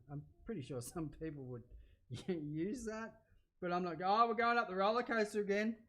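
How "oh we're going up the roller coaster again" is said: loud and high, caught up watching the ride; excited shouting. Real, not acted. Cook watching roller coasters shouts at the screen excitedly. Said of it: "I don't know why I'm shouting".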